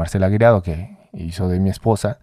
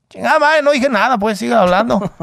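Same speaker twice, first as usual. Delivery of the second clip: a defeated champ's grumble, pitched up; imitating Julio; 13 semitones higher than usual. Julio gives up, retold.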